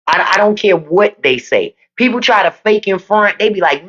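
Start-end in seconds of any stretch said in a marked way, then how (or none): none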